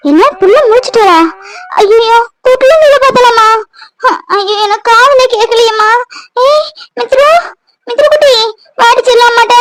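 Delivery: put-on frantic high cries for the baby; panicked, high. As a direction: rush to the crying baby in alarm. Panicked mother rushing to crying baby. (குழந்தைக்காக பதறிய உயர்ந்த குரல்)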